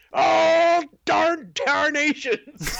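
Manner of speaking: high, very slow hillbilly drawl. Retold or imitated. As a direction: stretch it out like a prospector. Hick general voice continues.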